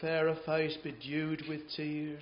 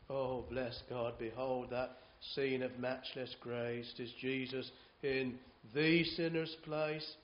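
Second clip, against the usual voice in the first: slow, softer, reverent hymn verse; hymn recitation; 2 semitones lower, 4 dB quieter, slower than usual. Acted. To the chapel, the preacher recites a hymn verse slowly and reverently. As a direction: savour each line of the old hymn.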